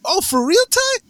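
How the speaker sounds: high, rising incredulous question; retold surprise. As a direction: act amazed at a friend's news, voice climbing. Retold surprise at friend joining college.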